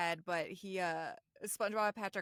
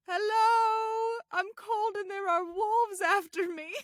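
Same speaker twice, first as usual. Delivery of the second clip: put-on high, slow creaky old man; high old Grandpa voice; 12 semitones higher, 10 dB louder, slower than usual. Retelling the show, Grandpa calling out in a high, slow voice. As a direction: play a pitiful old grandpa, slowly. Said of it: "And he's like".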